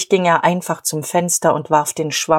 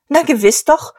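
higher and louder, gloating; triumphant; 9 semitones higher, 4 dB louder than usual. Acted. Guste gloating at Emmy about the lieutenant, triumphant and high. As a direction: crow it in triumph.

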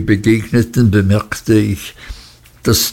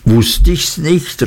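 higher, slow triumphant exclamation; exclaiming triumphantly; 7 semitones higher, slower than usual. A narrator's reading. Narrator to Steerforth, triumphantly exclaiming. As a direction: draw it out, delighted to be proven right. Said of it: "rief ich aus".

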